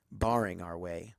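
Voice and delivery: plain reading voice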